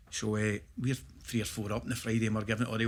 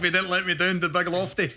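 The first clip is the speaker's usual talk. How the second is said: ordinary delivery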